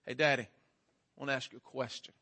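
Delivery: low, soft imitation of a solemn child; serious little daughter. Eight-year-old daughter approaching Daddy with a question, quietly serious.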